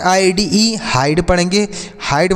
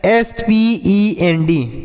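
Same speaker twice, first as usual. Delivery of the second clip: letter by letter, slowly, for the class; dictating letters slowly to children; slow spelling; 3 semitones higher, much slower than usual. Shown as a demonstration. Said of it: "spells the word letter by letter".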